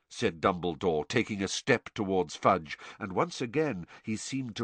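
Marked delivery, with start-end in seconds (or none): none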